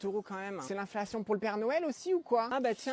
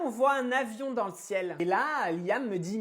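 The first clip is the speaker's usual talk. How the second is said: ordinary talk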